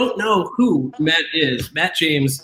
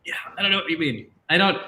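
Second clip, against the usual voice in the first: ordinary talk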